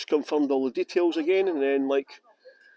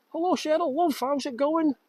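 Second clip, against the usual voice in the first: high-pitched Scouse greeting; much higher, Scouse-tinged hello; Scouse accent; 11 semitones higher than usual. Pretend. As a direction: greet her in a sing-out Scouse voice. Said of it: "That was a scouse there".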